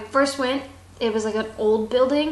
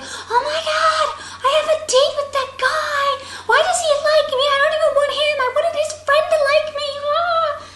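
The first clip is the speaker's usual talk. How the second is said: put-on very high, loud squealing girl; giddy high-pitched freak-out; excited squeal; 5 dB louder, faster than usual. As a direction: gush about the date in a high shriek. Excited girl about her date.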